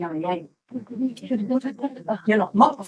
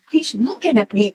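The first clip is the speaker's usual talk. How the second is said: normal talking voice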